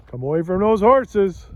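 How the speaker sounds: loud call to the dogs. Real, not acted. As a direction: shout across the field. Calling dogs away from horses.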